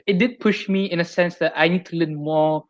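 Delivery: ordinary delivery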